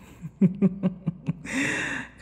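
high playful laugh; teasing laugh. Acted. Vampire laughing at the flustered captive, playful laugh. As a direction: laugh at their blush.

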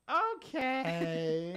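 put-on high old lady voice; ignorant dog owner voice. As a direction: sing out a dopey okay like an old lady. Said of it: "ignorant dog owner voice".

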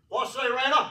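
loud, high call to a buddy; hollered greeting. Retold or imitated. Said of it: "I always addressed him at work".

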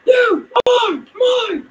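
long, high drawn-out scream; shrieked claim over an opponent; upset screaming. Real, not acted. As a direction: scream it slowly at full pitch. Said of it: "Why I'm screaming is because I'm getting really upset".

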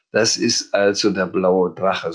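ordinary delivery, nothing marked

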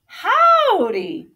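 high, loud, hearty greeting; friendly calling out. A narrator's reading. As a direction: hail a newcomer cheerily. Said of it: "a friendly voice called out".

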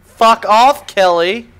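loud, annoyed yell; loud angry retort; angry shout. Real, not acted. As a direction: yell it at the radio voice. Yelling at radio sergeant.

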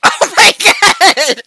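high, loud, drawn-out scream laughed out; startled shriek. Real, not acted. Gamer reacting to a friend's table fling, startled shriek. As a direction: shriek it in amused disbelief. Table sent flying in game.

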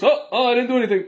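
high, fast whiny protest of innocence; mock innocent hockey player. Vlogger imitating a hockey player pleading innocence to the referee. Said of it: "the guy goes just".

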